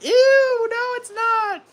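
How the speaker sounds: very high squealing mock disgust; mock disgusted Maddie. Imitating wife refusing tomatoes, high mock squeal. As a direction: squeal it like a grossed-out girlfriend. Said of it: "She's like".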